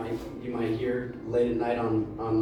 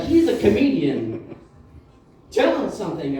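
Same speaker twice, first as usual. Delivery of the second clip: high, loud boast to strangers; eager woman promoting her date; pushy introduction; 6 semitones higher, 7 dB louder than usual. Retold or imitated. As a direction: show him off and egg him on. Woman touting him to strangers.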